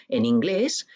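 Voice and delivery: usual speaking voice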